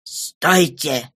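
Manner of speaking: usual speaking voice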